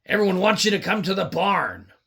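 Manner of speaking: ordinary delivery, nothing marked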